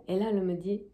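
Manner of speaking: ordinary talk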